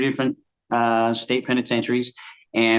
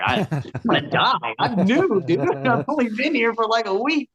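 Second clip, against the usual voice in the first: hammed-up terrified pitch leap; panicked newbie; 10 semitones higher than usual. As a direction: voice jumps up high in mock panic. Newbie terrified of the skinhead leader.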